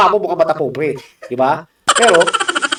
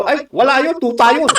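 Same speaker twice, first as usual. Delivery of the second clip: higher, scoffing bystander voice; dismissive gossip; 9 semitones higher, 3 dB louder than usual. Retold or imitated. A bystander brushing off the senator, scoffing. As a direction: wave him off with a sneer. Imitating people's dismissive answers.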